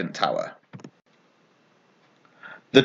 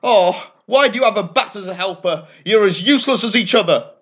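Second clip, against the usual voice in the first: screaming; 7 semitones higher than usual. A narrator's reading. Angry witch scolding Dot over her bat, high fast scream. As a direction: rattle off the insult at her in a fury. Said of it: "and screamed at her".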